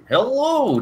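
squeaky high greeting; high sing-song hello. Acted. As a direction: chirp it up high.

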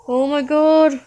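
loud scared outburst. Real, not acted. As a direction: cry out in alarm. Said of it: "I'm scared".

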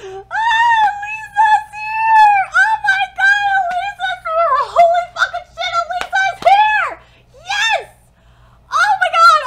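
ecstatic screaming. Real, not acted. Vlogger thrilled her favourite gym leader appears, ecstatic screaming. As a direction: scream with pure fan joy. Fan screaming about Lisa.

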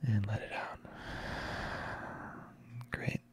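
lilting cues up for inhales, hushed on exhales; guided breathing. Real, not acted. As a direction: pace the breath with long pauses, letting each exhale fall soft. Leads a guided breathing exercise.

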